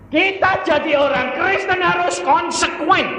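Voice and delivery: high, shouted preaching; loud, high sermon cry; forceful exhortation. Real, not acted. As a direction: drive it home to the congregation. Exhorts the congregation at full force.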